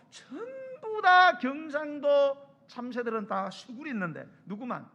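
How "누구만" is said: ordinary talk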